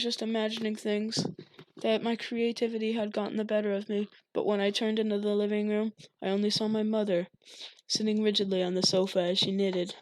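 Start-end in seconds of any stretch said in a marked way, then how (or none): none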